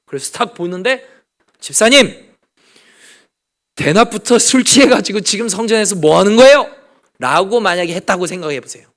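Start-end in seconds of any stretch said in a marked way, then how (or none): 1.6-6.8 mock scolding. Pastor imagines scolding a congregant.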